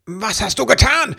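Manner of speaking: high, loud angry outburst; furious elf confronting the culprit; snapping at her. A narrator's reading. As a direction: lash out at her with the question. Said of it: "fuhr Ribbelwitsch sie an".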